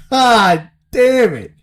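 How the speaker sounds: high, loud, slow drawn-out curse; anguished groan. Retold or imitated. Batter seeing Chapman come in, drawn-out high wail.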